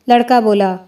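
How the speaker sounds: usual speaking voice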